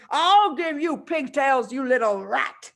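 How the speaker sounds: loud, slow, vicious yell while swinging. Acted. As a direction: snarl it out mid-swing. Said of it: "the Trunchbull was yelling".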